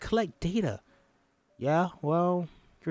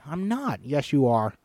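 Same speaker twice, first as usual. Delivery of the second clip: ordinary delivery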